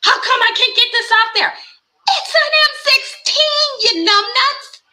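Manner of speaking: loud, high mock outrage; high-pitched incredulous holler, stretching the punch words; exasperated yelling. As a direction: yell it like scolding an idiot. Acting out yelling at the offender.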